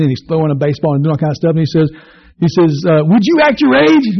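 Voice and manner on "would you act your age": much higher, louder scold; father scolding his playful son. Acted. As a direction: snap at the kid. Father scolding boy in movie.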